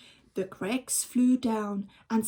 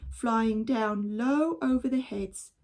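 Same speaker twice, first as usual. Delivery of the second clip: plain reading voice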